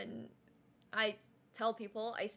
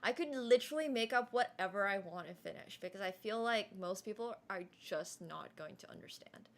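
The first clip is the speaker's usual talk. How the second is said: ordinary talk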